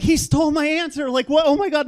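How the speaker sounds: high-pitched panicked outburst; freaking out. Retold or imitated. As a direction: retell it in a rising comic panic. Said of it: "And I'm freaking out".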